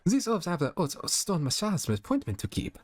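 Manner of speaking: high French diva voice on haute stone massage. Shown as a demonstration. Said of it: "Oh, okay".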